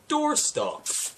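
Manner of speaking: one word popped up high; high pitched. Pretend. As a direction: squeak it out brightly. Comic list of book uses.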